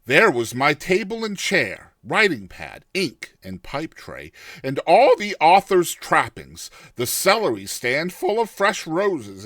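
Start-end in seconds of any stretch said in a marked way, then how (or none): none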